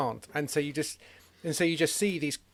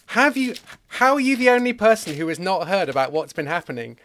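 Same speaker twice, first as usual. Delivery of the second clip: louder, astonished questioning; mock incredulous; 3 semitones higher, 6 dB louder than usual. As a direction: play disbelief at someone out of touch. Disciples incredulous at the stranger.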